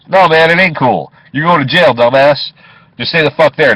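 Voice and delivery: loud, high furious telling-off; angry yell. Retold or imitated. Cab driver yelling at the waking thief, loud and angry. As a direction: bark him down so he stays put.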